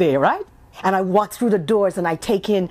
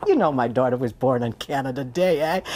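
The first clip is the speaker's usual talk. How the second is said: lower, proud Canadian-flavoured boast ending in eh; proud Canadian brag; 6 semitones lower than usual. Retold or imitated. Mother bragging to people about her daughter's Canada Day birth, lower voice. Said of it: "I would always start with the story".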